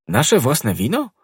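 slow, puzzled exclamation; a touch of disbelief; surprised. A narrator's reading. Athos surprised by the gift of wine. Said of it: "zawołał Athos zdziwiony".

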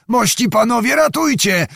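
high-pitched narrated call, volume contained; cry for help. A narrator's reading. Trapped rider to his comrades, high cry for help. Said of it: "krzyknął".

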